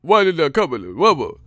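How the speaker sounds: loud mock mumble rap; mumble rapper parody. Mocking a bad rapper's album, loud nonsense rap. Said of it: "when we listen to it, it's shit".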